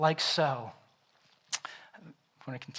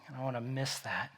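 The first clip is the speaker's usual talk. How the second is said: ordinary talk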